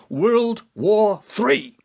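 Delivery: drawn out very slowly, ominous; slow grave question. Acted. To the President, slowly naming the coming war. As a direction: let each word land slowly like a verdict.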